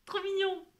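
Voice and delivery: high, delighted cooing; delighted squeal. Real, not acted. Crafter showing the viewer her crochet doll, delighted high coo. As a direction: gush over the cute doll.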